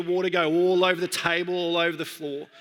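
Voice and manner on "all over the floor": ordinary talk, nothing marked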